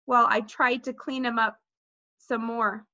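usual speaking voice

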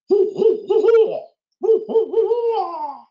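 loud, very high owl hooting; full-voiced imitation owl call; barred owl hoots. Shown as a demonstration. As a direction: hoot rhythmically, letting the last one swoop. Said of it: "It really sounds like".

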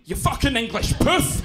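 loud, high Glaswegian insult; mock a rough Glasgow relative hurling abuse; rough Glaswegian accent. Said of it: "rough Glaswegian family, they just call me".

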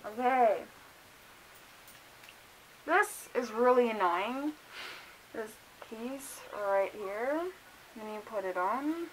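muffled behind leather mask; through a face mask. Real, not acted. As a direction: mouth covered while adjusting.

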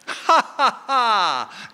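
high, mocking ha ha ha; mock laugh of God. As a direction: laugh down at puny man from on high. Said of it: "God would say".

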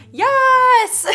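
high, loud "Yes!"; excited yell. Retold or imitated. Alien fan hearing the band is back, shouting with joy. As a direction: burst out in joy. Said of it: "and he's like".

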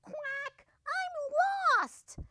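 tiny, very high duckling voice; small sad voice. A narrator's reading. Lost duckling alone in the woods, small sad voice. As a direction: whimper it high and little. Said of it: "a small, sad voice".

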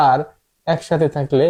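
ordinary talk

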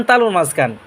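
ordinary talk

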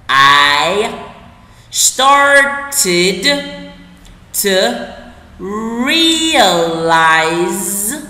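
loud, stretched words with long pauses; slow word-by-word. Shown as a demonstration. Teacher reads the sentence for learners, word by word. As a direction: draw out every word and syllable separately. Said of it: "đọc chậm từng từ một".

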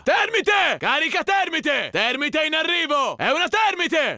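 urgent shout. Acted. Soldier warns his team of incoming thermite, shouting. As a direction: yell a warning to the squad.

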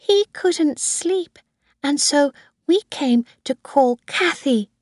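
put-on high-pitched little girl; Susan. A narrator's reading. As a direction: explain it in a small girl's voice. Little girl explains herself.

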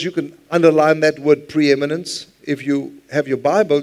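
normal talking voice

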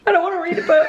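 high mock whine; hammed-up whiny refusal; childish whine. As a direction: protest like a sulky child. Acting out a sulky mood swing.

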